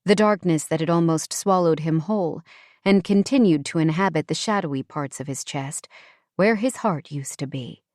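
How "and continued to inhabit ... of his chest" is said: ordinary delivery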